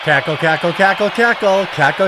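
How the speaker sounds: loud, sing-song mocking cackle chant; jeering cackle, then a fake ha ha ha. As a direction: ham up her laugh to ridicule it. Mocking Kamala Harris's laugh.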